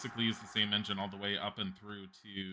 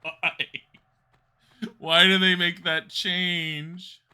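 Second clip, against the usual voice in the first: whiny; 7 semitones higher, 10 dB louder, much slower than usual. Real, not acted. Complaining about the change.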